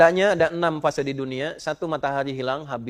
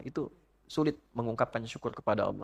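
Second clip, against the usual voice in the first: ordinary talk, nothing marked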